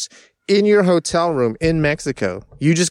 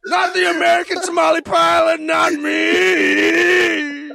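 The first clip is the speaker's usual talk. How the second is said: high, crying heroic shout; anguished shouting; 8 semitones higher, slower than usual. Acted. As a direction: shout it like a wounded hero. Acted tamale pilot heroic rant.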